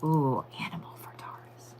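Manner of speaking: hushed, secretive whisper; teasing whisper. Real, not acted. Reader teasing viewers with the Taurus animal, whispered.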